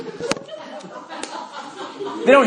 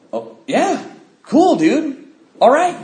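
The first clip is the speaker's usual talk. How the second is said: eager buddy voice; 9 semitones higher, 3 dB louder, much slower than usual. Retold or imitated.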